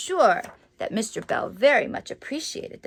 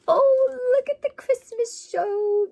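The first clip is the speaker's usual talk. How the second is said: gasp, then a higher, thrilled exclamation; delighted gasp; 12 semitones higher than usual. Real, not acted. Delighted reaction to the picture.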